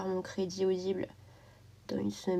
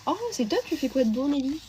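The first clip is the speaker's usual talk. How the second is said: much higher, delighted reaction; light up with delight; excited; 5 semitones higher than usual. Real, not acted. Streamer delighted by a viewer's news, excited.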